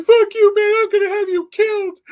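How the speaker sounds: very high, loud squeaky mock threat; mocking Donnie. Mocking Donnie's threat after the fight, very high, loud squeaky voice. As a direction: squeal the threat like a cartoon weakling. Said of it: "That's how he sounded".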